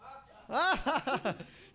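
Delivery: big, loud, high belly laugh. Shown as a demonstration. Leading the audience in Krishna's laugh, loud belly laugh.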